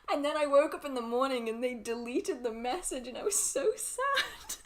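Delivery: high, teary, laughing voice; gushing. Real, not acted. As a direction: gush about the cute cat. Gushing about a cat video.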